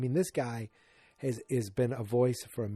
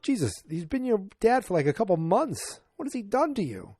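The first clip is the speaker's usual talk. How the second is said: incredulous retort; 8 semitones higher than usual. Real, not acted. Host's mock reply to the child.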